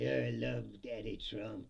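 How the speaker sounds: a hint of a fawning admirer; mock fawning fan. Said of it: "He's like".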